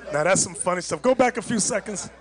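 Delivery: ordinary talk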